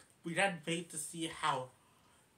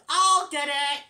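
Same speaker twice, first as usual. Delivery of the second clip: much higher and louder chipmunk yell; screaming; 11 semitones higher, 12 dB louder, slower than usual. Retold or imitated. Alvin leaping for the phone, high loud yell. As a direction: yell it out as you leap for the phone.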